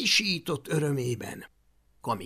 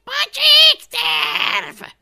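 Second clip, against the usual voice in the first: very high, slow loud squeal; screechy mini dragon; 15 dB louder, much slower than usual. Acted. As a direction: drag out the scornful verdict shrilly. Icy mini dragon answers at last.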